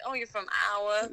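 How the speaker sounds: mock Minnesotan greeting an Iowan; Minnesota accent. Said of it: "you'll hear that accent actually truly come out".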